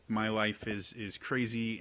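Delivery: ordinary talk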